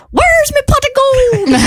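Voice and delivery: high stage-Irish squeal; Irish accent demo. Shown as a demonstration. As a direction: show them the Irish pot-of-gold line, hammed up. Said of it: "you have to say in an Irish accent".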